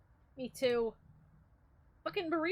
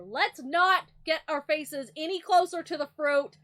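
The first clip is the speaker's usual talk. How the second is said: ordinary talk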